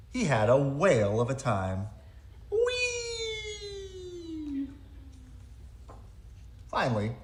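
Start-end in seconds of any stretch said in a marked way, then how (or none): 2.5-4.7 high, thrilled squeal; gleeful squeal. Acted. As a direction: squeal with delight. Penguin sliding with joy.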